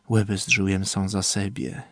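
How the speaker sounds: ordinary delivery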